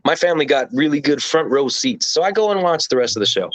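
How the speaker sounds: ordinary talk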